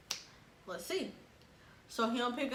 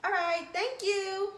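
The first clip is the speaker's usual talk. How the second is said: slow, high, overly polite thanks; fake polite goodbye; 10 semitones higher, slower than usual. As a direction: sugarcoat the goodbye. Polite goodbye, voiced sweetly.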